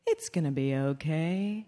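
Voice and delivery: soft soothing murmur; calm the baby gently. Retold or imitated. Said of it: "rub her back and say".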